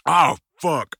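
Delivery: loud, high cry of sudden pain; sharp yelp of pain; pained outburst. Acted. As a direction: let it burst out as the bite lands. Partner bites him.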